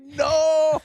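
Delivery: high, loud anguished "No!"; anguished cry. Retold or imitated. Dude behind them in the theater, imitated with a high loud wail. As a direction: wail it like a heartbroken fan. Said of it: "audibly just, like, reacted".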